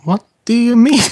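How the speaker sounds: high, loud acted disbelief; mock-innocent protest. Player acts character's protest when accused.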